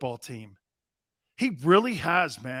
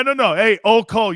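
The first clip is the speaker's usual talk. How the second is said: usual speaking voice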